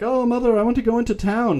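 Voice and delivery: mock a sulky sixteen-year-old begging; imitating a teenage girl asking her mom; whiny teen daughter. Teen daughter pleading with mother.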